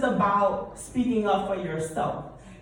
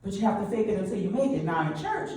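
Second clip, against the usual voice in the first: usual speaking voice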